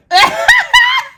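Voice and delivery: loud high whooping laugh; triumphant shouting laugh. Real, not acted. Caught it.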